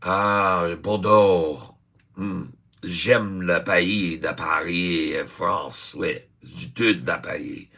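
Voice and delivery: slow, hammed-up French accent, savouring each word; overdone French accent, drawn out; mock French accent. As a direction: play a theatrical Frenchman gushing about Paris in a French accent. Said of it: "I can do a fairly good job of the French people".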